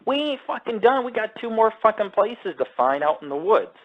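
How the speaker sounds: high, incredulous rant; exasperated. Retold or imitated. Scolding the panicking hiker, incredulous. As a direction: push back in disbelief.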